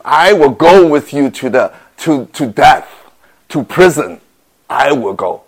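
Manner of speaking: loud, earnest promise to the death; fervent vow. Acted. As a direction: swear it with full conviction. Peter vows to follow Jesus.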